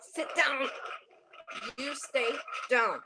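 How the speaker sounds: normal talking voice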